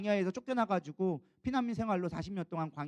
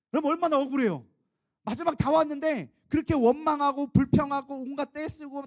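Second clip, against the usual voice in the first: indignant; 6 semitones higher, 8 dB louder than usual. Acted. Preacher protests Moses' unfairness.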